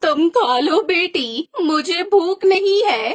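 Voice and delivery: slow, tearful refusal; a widow too sad to eat, voice breaking; grieving mother. Acted. Widowed mother refusing food from her daughter, tearful and slow.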